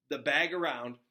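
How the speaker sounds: ordinary delivery, nothing marked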